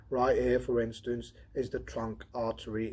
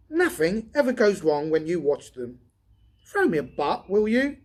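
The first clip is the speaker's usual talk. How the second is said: higher, louder voice for the redhead Donovan; Donovan voiced higher; 8 semitones higher, 11 dB louder than usual. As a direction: lift into the tired redhead's voice.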